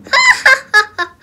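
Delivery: high, fast, loud giggle burst; gleeful laugh. Real, not acted. Kid laughing at getting in without paying, gleeful giggle. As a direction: cackle with delight at getting in free.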